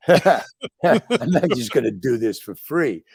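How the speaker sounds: slow words through real laughter; laughing. Real, not acted. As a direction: laugh your way through the excuse. Laughing while making excuses.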